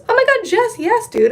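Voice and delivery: squealing hello to a friend in the chat; excited surprise. Real, not acted.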